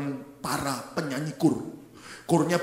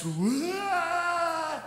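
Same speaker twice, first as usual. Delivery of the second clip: high rising wail; drawn-out mock crying; imitated woman's wail; 10 semitones higher than usual. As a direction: imitate a mother bursting into tears. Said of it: "ada satu ibu nangis".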